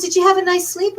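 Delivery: bright, high, welcoming hostess voice; sing-song hotel owner greeting; friendly innkeeper. Retold or imitated. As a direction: play the cheery host inviting them for coffee. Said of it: "She goes".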